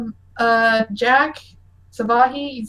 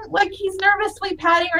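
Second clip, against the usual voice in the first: ordinary delivery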